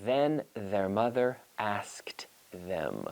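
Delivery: whispered, very slow "Then their mother asked them"; slow whispered twister. Shown as a demonstration. Teacher whispering the tongue twister slowly for students to try at home. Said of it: "So try it at home".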